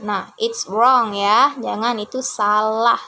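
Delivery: ordinary talk, nothing marked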